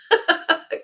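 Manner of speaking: high, breathy laugh; high peal of amusement. Real, not acted. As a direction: burst out in a real giggle. Laughs at own remark.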